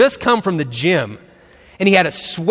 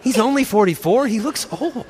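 ordinary talk

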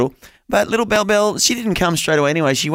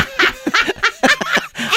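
real laugh. Real, not acted. Laughing at host's teasing.

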